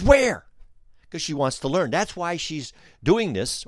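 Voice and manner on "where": high eager question, a bit louder. Retold or imitated. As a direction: ask it eagerly, wanting to see. Guide eagerly asks where.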